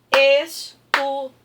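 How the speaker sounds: "estudante" punched out as es-tu-dan-te, loud; syllable by syllable. Shown as a demonstration. Teacher showing pupils how to clap out the word, syllable by syllable. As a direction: clap out "estudante" in four separate beats. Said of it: "e você vai bater".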